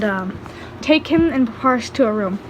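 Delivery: usual speaking voice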